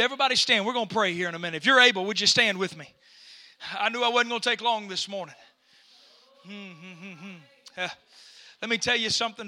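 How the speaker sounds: calm them down before prayer; soft pastoral talk. Acted.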